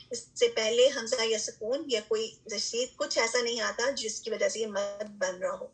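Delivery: normal talking voice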